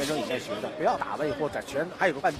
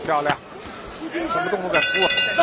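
loud, high amazed cry, slowed; excited; 12 semitones higher, 5 dB louder, slower than usual. Real, not acted. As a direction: marvel at the move out loud. Co-commentator reacts to kick.

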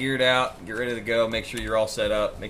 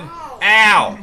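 sudden loud yelp of pain; 9 semitones higher, 11 dB louder than usual. Real, not acted. Hit in game.

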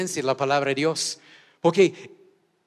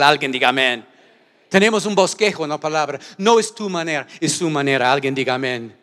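loud, driving preacher's rebuke; full-force preaching; 4 semitones higher, 8 dB louder than usual. Acted. Pastor rebuking those who follow God their own way, preaching loud. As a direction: hammer the point home to the church.

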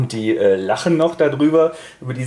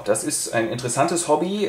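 ordinary talk

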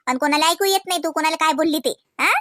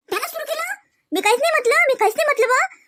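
high, sharp indignant denial; wounded innocence, pitched well up; indignant protest; 12 semitones higher than usual. Acted. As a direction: squeal that she did nothing. Rashmi protests her innocence, pitch up.

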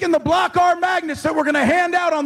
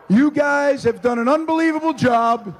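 ordinary talk, nothing marked

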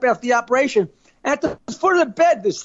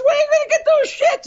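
much higher, quick question; high-voiced friend; faster than usual. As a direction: rush it out up high.

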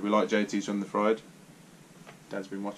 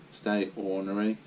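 ordinary talk, nothing marked

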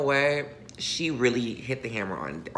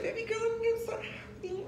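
much higher squealing quote; retold squeal. Retelling to the giver how she squealed on hearing. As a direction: squeal it like you did back then. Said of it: "I said".

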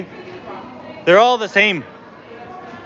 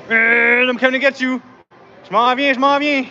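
playful taunt; 6 semitones higher than usual. Pretend.

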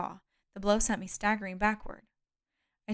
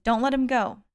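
loud, higher-pitched command; urgent order; 4 semitones higher, 8 dB louder than usual. A narrator's reading. Jason orders captive held.

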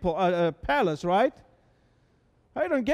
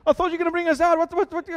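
higher, agitated mock panic; a lightly acted frantic Moses crying out to God; 6 semitones higher, 3 dB louder than usual. Moses panics to God with Egyptians closing in, frantic. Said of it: "Moses must be frantic too".

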